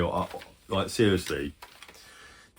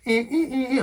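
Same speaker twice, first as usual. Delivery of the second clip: high, clipped beeps like an alarm clock; imitating a beeping alarm; mock alarm beeping. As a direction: beep it out like an alarm going off. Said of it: "I'm just gonna hear".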